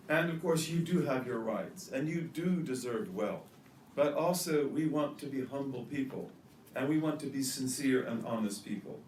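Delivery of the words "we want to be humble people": normal talking voice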